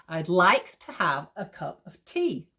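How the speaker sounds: slow, measured beat on "like", "have", "cup", "tea"; iambic stress. Shown as a demonstration. Teacher saying it out loud to hear, iambic stress. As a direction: lean on every second word up to "tea". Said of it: "need to say stuff out loud".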